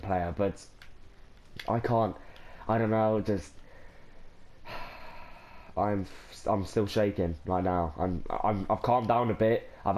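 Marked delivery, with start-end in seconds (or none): 4.6-5.3 long unvoiced overwhelmed sigh; breathy sigh. Real, not acted. Overwhelmed fan pausing mid-video, sighing. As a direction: let out a shaky breath.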